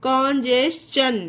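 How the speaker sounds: slow कॉन्जेशन, ending "jes-chun"; ch sound for T. Shown as a demonstration. Teacher repeating the example, slow कॉन्जेशन with "ch". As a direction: draw out कॉन्जेशन with a clear "ch". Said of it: "चा पढ़ा गया है".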